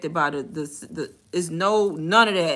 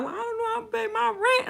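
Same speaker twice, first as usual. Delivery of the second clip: much higher mock-tearful caller voice; mock crying caller; 3 dB quieter than usual. Bank worker imitates a sobbing caller in a much higher voice. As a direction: play a caller breaking down about rent. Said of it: "when the people start bust out crying".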